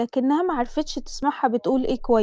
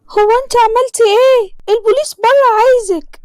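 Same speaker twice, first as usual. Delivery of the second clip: much higher, louder alarmed mother; 10 semitones higher, 7 dB louder than usual. Acted. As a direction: burst in frightened by the police.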